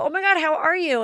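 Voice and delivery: high, gushing hello; excited greeting. Retold or imitated. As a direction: squeal hello to an old friend. Greeting friend in passing.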